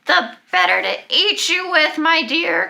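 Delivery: high, loud wolf cry. Acted. The wolf reveals itself to the girl, high and loud. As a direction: let the wolf burst out with the line. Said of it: "cried the wolf".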